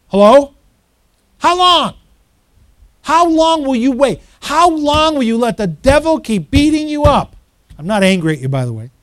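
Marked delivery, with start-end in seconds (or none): 0.1-7.4 loud, high-pitched challenge to the congregation; impassioned preaching shout. Real, not acted. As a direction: shout it from the pulpit, fired up at the devil. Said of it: "that's when pastors preach and shout".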